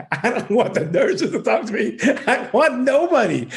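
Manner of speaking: high-pitched words squeezed out through real laughter; laughing. Real, not acted. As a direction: laugh helplessly at your own story while telling it. Laughing at own retold demand.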